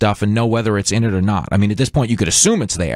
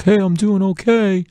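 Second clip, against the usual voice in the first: high, slow, smug and content; smug guy voice; 9 semitones higher than usual. Retold or imitated. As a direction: play the self-satisfied guy. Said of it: "the guy who's like".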